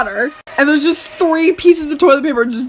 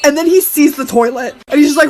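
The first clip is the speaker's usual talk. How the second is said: ordinary talk, nothing marked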